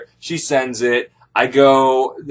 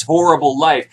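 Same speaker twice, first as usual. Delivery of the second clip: usual speaking voice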